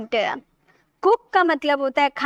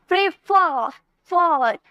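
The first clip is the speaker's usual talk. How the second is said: Prefer with a long, weighted "फॉर", then that syllable alone; stress on final syllable; slower than usual. Shown as a demonstration. Teacher showing where the stress falls, फॉर heavily stressed. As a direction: hit and stretch the फॉर in prefer. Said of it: "एकदम जोर देकर बोल रहे".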